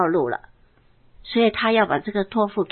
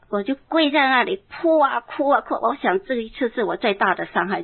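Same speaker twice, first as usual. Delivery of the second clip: ordinary delivery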